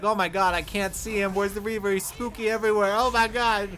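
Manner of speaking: loud, breathless confusion; panicked. Retold or imitated. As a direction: flail around in a panic.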